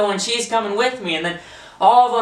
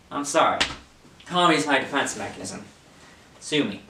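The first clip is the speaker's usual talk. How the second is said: ordinary talk, nothing marked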